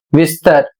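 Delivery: ordinary talk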